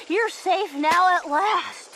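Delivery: ordinary delivery, nothing marked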